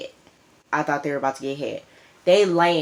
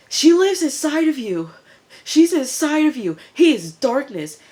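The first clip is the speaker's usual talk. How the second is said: much higher, louder spooked voice; hammed-up possessed warning; frantic cult member; 10 semitones higher, 4 dB louder than usual. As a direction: shriek it like someone seeing a demon. Cult guy calls Pope darkness.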